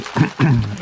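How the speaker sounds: rough throat clear before speaking. Real, not acted. Vlogger apologizing for their voice, clearing throat. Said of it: "Again, I'm sorry for my, my voice today".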